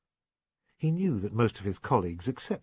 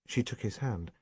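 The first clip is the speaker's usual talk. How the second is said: ordinary delivery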